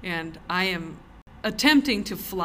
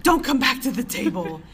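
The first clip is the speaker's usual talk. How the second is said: louder, higher, offended order; offended diner sending the magician off; upset shooing; 5 semitones higher, 7 dB louder than usual. Retold or imitated. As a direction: shoo him away in a huff.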